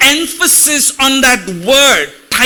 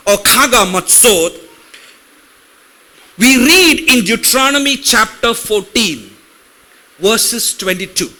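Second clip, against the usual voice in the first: usual speaking voice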